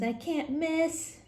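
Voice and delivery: put-on a kid bragging mid-shot; cocky kid. Kid playing basketball, slow mock boast. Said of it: "he says".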